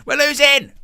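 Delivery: high shouted mock outrage; mock yell. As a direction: yell it like an indignant fan. Mocking the Romanian fans.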